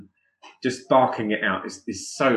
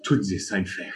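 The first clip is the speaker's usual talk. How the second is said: German line. Acted. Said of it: "just do it in German".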